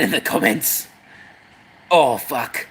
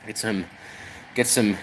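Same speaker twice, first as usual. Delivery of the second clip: ordinary delivery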